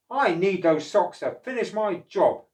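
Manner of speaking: loud, sharp cross reply. Acted. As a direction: snap back at the octopus.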